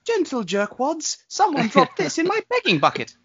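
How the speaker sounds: put-on very high, slow British child voice; earnest little beggar boy piping up; high childish British voice; Tinny Tim impression. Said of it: "Give me another Tinny Tim quote".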